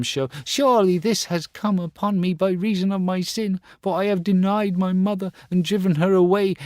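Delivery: high, loud, remorseful vow; weeping boy swearing to find his mother. Acted. Star Child weeping on the grass, high and remorseful.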